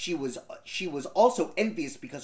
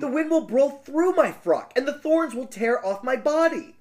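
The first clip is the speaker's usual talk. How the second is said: put-on higher, pleading young girl; 7 semitones higher, 3 dB louder than usual. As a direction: beg in a high girlish voice. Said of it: "said the girl".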